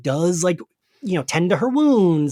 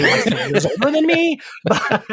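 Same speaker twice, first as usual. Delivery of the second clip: higher, breaking into laughter; laughing; 4 semitones higher than usual. Real, not acted. Host joking that Giles is barely older, laughing. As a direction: laugh through the realization. Laughs at her own age comparison.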